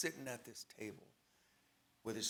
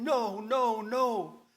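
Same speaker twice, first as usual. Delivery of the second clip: loud, high, desperate no; screaming no; 7 semitones higher, 8 dB louder, much slower than usual. Retold or imitated. The prisoner clinging to the cop, screaming in terror. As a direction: scream it as if being sucked under. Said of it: "and I'm screaming".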